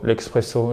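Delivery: "l'espresso" said with a ks, lek-spresso; "l'espresso" as French "l'expresso"; Frenchified X pronunciation. Shown as a demonstration. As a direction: put an X sound into "l'espresso". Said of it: "en mettant un X".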